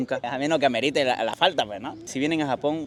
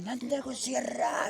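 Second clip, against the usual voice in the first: jefe japonés gritando; 11 semitones higher, 6 dB quieter, much slower than usual. Retold or imitated. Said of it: "vino gritándome".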